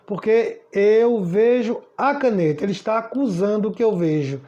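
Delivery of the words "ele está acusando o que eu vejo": ordinary talk, nothing marked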